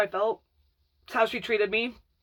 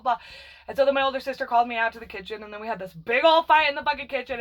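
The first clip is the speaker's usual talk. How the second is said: ordinary talk, nothing marked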